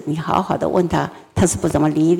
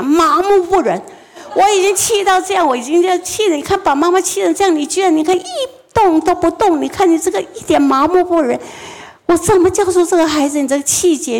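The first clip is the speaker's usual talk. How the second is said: furious scolding mother; 7 semitones higher than usual. Retold or imitated. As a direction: let the mother's fury pour out at the child. Mother scolding blank-faced child.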